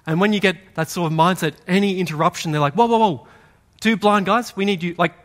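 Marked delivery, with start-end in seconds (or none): 2.7-4.9 sharp, raised mock rebuke; harsh hushing. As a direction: hush them harshly, a bit louder and higher. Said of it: "this is harsh how they tell them to, to be quiet".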